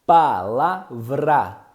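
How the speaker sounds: palavra drawn out slowly, the vra clear; slow demo word. Shown as a demonstration. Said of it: "Por exemplo". ("palavra" bem devagar, destacando o "vra")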